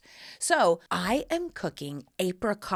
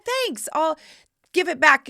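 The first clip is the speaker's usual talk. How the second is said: high and loud, polite gush; eager thanks; 9 semitones higher, 8 dB louder than usual. Retold or imitated. Guest promises Janet she'll return the sweater, high and loud. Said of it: "I was like".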